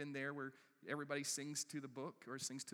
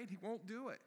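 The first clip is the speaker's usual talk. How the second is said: ordinary talk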